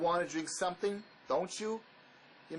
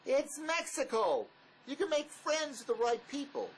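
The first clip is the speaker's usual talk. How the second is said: excited; 10 semitones higher than usual. Acted. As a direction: lift it up like it's the obvious answer. Pitching the Mexico plan.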